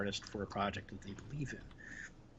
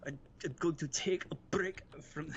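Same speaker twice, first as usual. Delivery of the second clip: frustrated, higher-pitched mock German accent; Halka; 7 semitones higher than usual. Friend imitating a German teammate fed up with the game, mock-frustrated German accent. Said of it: "I don't do a good Halka impression".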